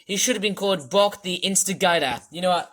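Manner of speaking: ordinary talk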